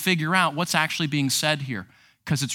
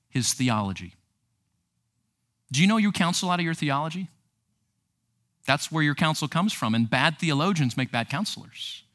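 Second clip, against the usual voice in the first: ordinary talk, nothing marked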